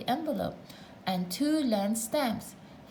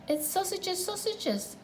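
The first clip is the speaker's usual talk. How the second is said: high, light sing-song offer; twin voice; 10 semitones higher than usual. A narrator's reading. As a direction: offer it sweetly as the other twin. Twin adding lunch offer.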